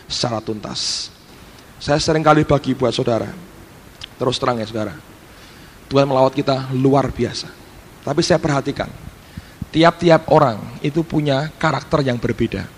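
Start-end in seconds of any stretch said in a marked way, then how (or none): none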